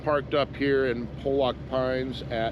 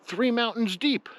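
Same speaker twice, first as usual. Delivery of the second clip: amazed disbelief; 10 semitones higher than usual. Real, not acted. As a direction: marvel at the absurd distance. Amazed repeat of the skier's answer.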